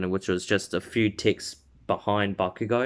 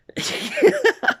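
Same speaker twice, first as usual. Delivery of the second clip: high giggle; 4 dB louder than usual. Real, not acted. Laugh after Kirishima line.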